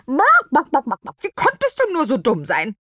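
high, loud clucking scold; cluck, then tell off a silly friend; scolding chicken voice; stern hen. A narrator's reading. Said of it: "fragte Nofretete streng".

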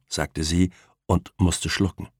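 usual speaking voice

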